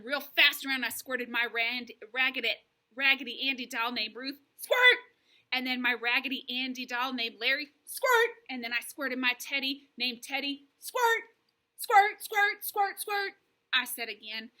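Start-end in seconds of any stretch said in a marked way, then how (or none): 4.6-5.0 high, loud, sudden squirt; squirt noise. A narrator's reading. Girl pretend-squirting her doll, high loud squirt. 7.8-8.3 high, loud, sudden squirt; squirt noise. A narrator's reading. Girl pretend-squirting her doll, high loud squirt. 10.8-13.3 high, loud, rapid-fire squirts; playful squirting. A narrator's reading. Girl pretend-squirting her teddy, high playful squirts.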